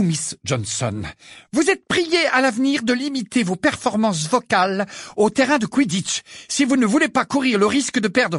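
high, loud teacher's reprimand; stern rebuke. A narrator's reading. Teacher rebukes two pupils.

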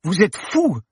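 high, loud outburst; exploding at him. Acted. Paloma explodes at the professor after landing, loud and high. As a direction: burst out at him. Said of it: "explosa-t-elle".

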